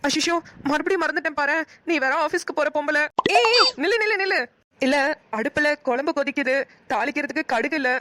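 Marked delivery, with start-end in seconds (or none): 3.4-4.4 loud high call to stop her. Acted. Neighbour shouts to stop woman leaving for office, loud call. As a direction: shout after her as she leaves.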